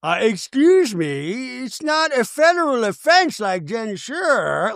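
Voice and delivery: high, slow, exaggerated drawl; mock someone drawing out an indignant objection; Meredith. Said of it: "remember Meredith was like".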